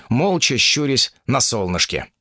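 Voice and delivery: usual speaking voice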